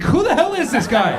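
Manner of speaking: high, loud, baffled exclamation; incredulous outburst. Acted. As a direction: cry out in comic disbelief. Acted outraged staff reaction.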